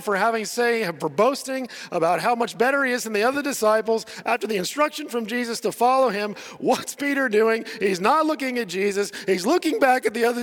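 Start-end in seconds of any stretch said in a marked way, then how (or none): none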